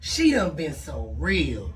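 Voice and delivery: low, slow put-on bug voice. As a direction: drag it out in a deep bug voice. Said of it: "Let me get in my bug voice".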